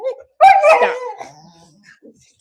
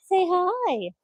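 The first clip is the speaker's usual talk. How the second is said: higher coaxing pet talk; 12 semitones higher than usual. Real, not acted. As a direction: coax the dog sweetly. Baby talk to the dog.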